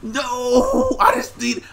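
loud, high, slowed wail of loss; real despair after a near win; anguished wail. Real, not acted. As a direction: cry out at losing so close. Losing at the very end.

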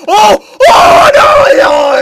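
very high, loud wail of dismay; shrieked "oh no" in real dismay; anguished outcry. Real, not acted. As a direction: cry out at a near miss. Just misses a save or goal.

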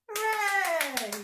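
loud, high hooray; cheering hooray. Acted.

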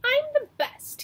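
high, loud bragging little mouse; boastful mouse. Acted. Show-off mouse bragging to the forest, high and loud. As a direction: crow it out like a tiny show-off. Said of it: "he said".

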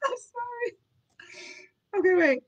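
high, squeezed words after real laughter; laughing apology. Real, not acted. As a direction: try to recover from the giggles. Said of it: "I know I shouldn't have laughed".

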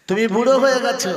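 "তুমি বুড়ো হয়ে গেছো" cried out high, loud and fast; anguished preaching cry. Acted. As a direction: fling the repeat out as an anguished cry. Preacher repeats line, then cries out.

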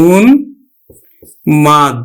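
উন্মাদ stretched very slowly, ন held into ম; slow careful model of উন্মাদ; slow teaching demo. Shown as a demonstration. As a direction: draw out উন্মাদ for the students. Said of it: "আমরা উচ্চারণ করব কিন্তু".

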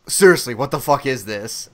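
low, firm exasperation; fed-up disbelief. Retold or imitated. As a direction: drop the pitch and demand an explanation. Later reaction as film goes on.

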